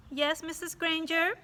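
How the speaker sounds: put-on high, sweet innocent kid voice; innocent boy. A narrator's reading. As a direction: sound like a little angel. Child answers teacher innocently.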